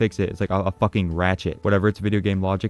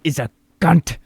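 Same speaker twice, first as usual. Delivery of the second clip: higher, louder villain snarl; villain line; 7 semitones higher, 5 dB louder than usual. Retold or imitated. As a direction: sneer it like the villain. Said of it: "He has some badass dialogue".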